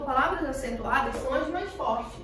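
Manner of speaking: normal talking voice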